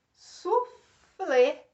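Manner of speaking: "suflê" split, loud and high; "suflê" in two beats, su, then FLÊ; stress on last syllable. Shown as a demonstration. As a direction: punch FLÊ at the end of "suflê". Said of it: "Última sílaba mais forte".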